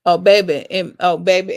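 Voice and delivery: loud, playful character exclamation; Miss Jenkins. Acted. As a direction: burst out with it in a put-on character voice. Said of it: "in my, my Miss Jenkins voice".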